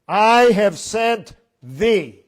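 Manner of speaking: "I have sent thee" slow, loud, I leaned on; stress on I. Shown as a demonstration. Preacher giving God's words, slow and loud. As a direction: drag it out, pressing on I. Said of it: "I is the emphasis".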